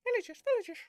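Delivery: loud, high, playful repeat; excited exclaim. Pretend. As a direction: belt it out with glee. Repeats the word loudly, higher.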